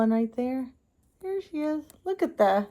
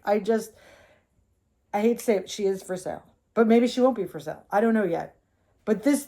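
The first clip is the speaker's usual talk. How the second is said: usual speaking voice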